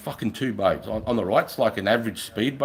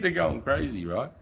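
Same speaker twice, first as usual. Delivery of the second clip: ordinary delivery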